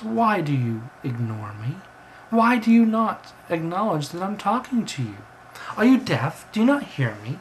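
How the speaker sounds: high, insistent bird demanding attention; indignant talking bird. Acted. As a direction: scold the human for ignoring you.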